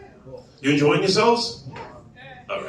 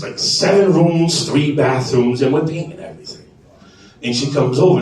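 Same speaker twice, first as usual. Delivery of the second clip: ordinary talk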